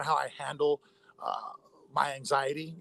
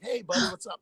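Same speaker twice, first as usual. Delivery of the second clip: retold chummy hello, pitched high; casual greeting; 8 semitones higher than usual. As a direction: greet him breezily despite the injury, voice up high. Injured man casually greeting paramedic.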